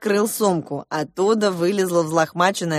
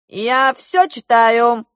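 loud, slow, higher menace; malicious general, raised voice; spiteful declaration; 5 semitones higher, 6 dB louder, much slower than usual. A narrator's reading. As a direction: declare it nastily, drawing it out. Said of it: "злобно заявил он".

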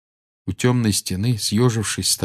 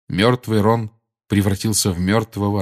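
ordinary delivery, nothing marked

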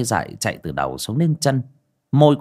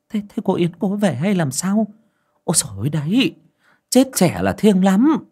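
higher, hurried and uneasy village voice; a hint of a frightened villager; 9 semitones higher than usual. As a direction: whisper of dread about the young dead.

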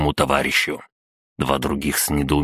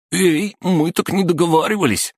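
very high, squeaky goon voice; squeaked protest; 3 dB louder than usual. A narrator's reading. Смуглый protesting losing the phones, in a squeak. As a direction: squeak out an indignant objection. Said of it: "пискнул Смуглый".

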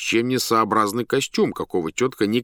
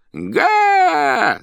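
very high, loud shout over the roar; loud clown shout; 7 dB louder than usual. A narrator's reading. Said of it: "закричал хозяин". (громкий клоунский выкрик)

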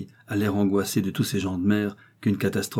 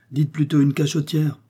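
insinuating; 6 semitones higher, 3 dB louder than usual. A narrator's reading. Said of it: "insinua Léocadie".

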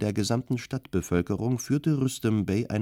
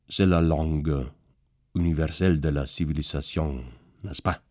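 offhand, lower voice; casual; 4 semitones lower than usual. A narrator's reading. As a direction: toss it off lightly, a man of the world. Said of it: "sagte Rüstem Bey lässig".

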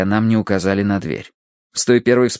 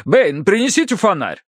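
proud colonel showing off, pitched high; proclaiming; 10 semitones higher than usual. A narrator's reading. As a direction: exclaim grandly, then order the butler. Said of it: "провозгласил полковник". (восторженно, во всеуслышание)

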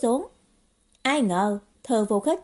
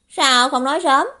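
loud, high scolding question; indignant youthful outburst; exclaimed reproach; 5 semitones higher, 8 dB louder than usual. Acted. Trách móc, vội vã đứng dậy. (kêu lên trách móc)

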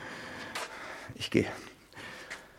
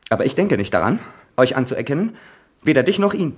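louder, climbing to a high cry; defiant shout; 4 semitones higher, 4 dB louder than usual. Acted. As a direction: throw the refusal in her face. Son defies mother in quarrel.

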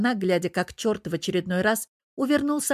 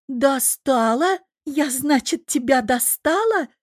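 slow, high, loud incredulous question; drawn-out high girlish indignation; indignant echo; 7 semitones higher, 4 dB louder, slower than usual. A narrator's reading. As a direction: throw his word back, outraged. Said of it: "повторила Тереза".

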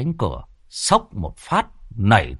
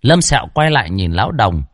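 ordinary talk

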